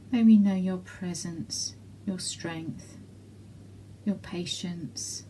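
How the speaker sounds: usual speaking voice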